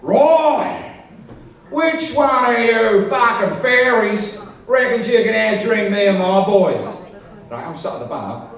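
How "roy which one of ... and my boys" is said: high, roaring mock challenge; hammed-up drunken Aussie pack leader; rowdy Aussie challenger. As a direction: bellow it slowly to the whole bar. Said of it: "challenged the entire bar to a drinking contest by going".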